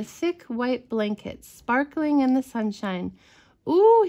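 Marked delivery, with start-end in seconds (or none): 3.7-4.1 high, loud drawn-out ooh; storybook cub's excited exclamation; excited cry. A narrator's reading. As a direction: cry out in delight at the snow. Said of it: "he cried".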